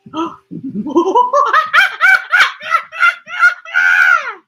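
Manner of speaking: big high gasp of wonder; a long rising gasp of delight. Real, not acted. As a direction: gasp as if you just found a treasure. Spots animals on the page.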